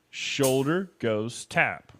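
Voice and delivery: spelled out slowly, word by word. Shown as a demonstration. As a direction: count it out slowly like a teacher. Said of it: "very slowly".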